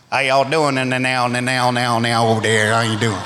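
lightly put-on Arkansas country drawl; a hint of Arkansas banjo twang in the greeting. Shown as a demonstration. Preacher demos how Arkansas folks greet, light Arkansas twang. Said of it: "we speak banjo".

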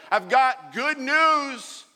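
high, drawn-out proclamation; loud herald. Acted. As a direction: call the victory out to a whole city. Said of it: "often the loudest and the fastest person".

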